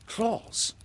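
put-on high, startled boy's echo; high-pitched childlike surprise; startled question. A narrator's reading. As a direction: echo the word high, in disbelief. John echoes Peter's word, startled.

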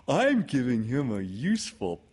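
high, excitable bear voice; Pooh. Acted. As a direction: pipe it out eagerly as a storybook bear. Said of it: "said Pooh".

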